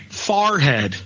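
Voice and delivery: farhead, with far for fore; Philly farhead. Shown as a demonstration. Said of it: "they would say".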